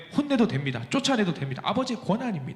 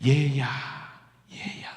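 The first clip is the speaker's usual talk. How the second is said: kind voice; 5 semitones lower, much slower than usual. Acted. God tenderly calling his rebellious children. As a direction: call them home tenderly. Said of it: "세상 인자한 목소리로".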